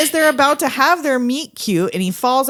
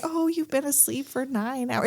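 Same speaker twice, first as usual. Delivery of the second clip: put-on very soft old-lady voice; hushed, motherly old-lady impression; 2 semitones higher, 10 dB quieter than usual. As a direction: coo softly like an old lady. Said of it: "say it like an old lady".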